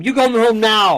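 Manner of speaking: hammed-up stern dismissal; mock stern order. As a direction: order the kid out in an over-the-top stern voice. Joking ejection, repeated louder.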